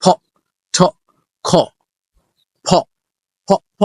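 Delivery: ফ, ঠ, খ with the air pushed out; aspirated sounds. Shown as a demonstration. Teacher drills students on aspiration, breathy. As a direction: breathe hard through ফ, ঠ and খ, then ফ again. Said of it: "মানে বাতাসটা বের করতে হবে".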